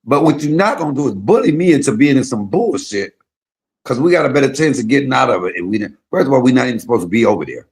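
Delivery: put-on loud defiant teen warning; defiant retold warning. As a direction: tell the troublemaker you won't be bullied. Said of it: "So I said".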